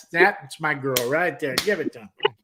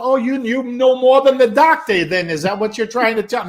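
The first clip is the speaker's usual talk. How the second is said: loud, high, indignant mock argument; hammed-up New York squabble; bickering New Yorker; 9 semitones higher, 9 dB louder than usual. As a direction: challenge your old friend, voice shooting up. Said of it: "these guys were at it".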